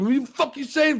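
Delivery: high-pitched outburst, playing the furious defender; hammed-up indignant challenge; mock angry confrontation. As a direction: charge in demanding answers, half laughing. Acting Joel charging in.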